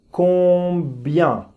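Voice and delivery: "Combien" said slowly as "kɔbjɛ", lips exaggerated; careful model word. Shown as a demonstration. Teacher shows lip shape of "Combien". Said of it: "Mira bien mis labios".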